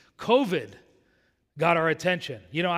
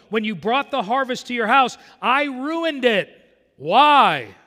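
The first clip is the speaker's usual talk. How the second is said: higher and louder, slow on I ruined it; fiery preaching rebuke; 6 semitones higher, 7 dB louder, slower than usual. Acted. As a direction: hammer home God's judgment. Preacher voicing God's rebuke.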